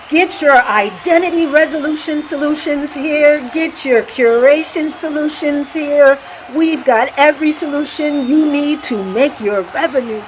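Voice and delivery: high, excited auctioneer hype; frenzied auctioneer. Pretend. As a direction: sell it like a fast auctioneer. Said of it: "Explain like a frenzied auctioneer".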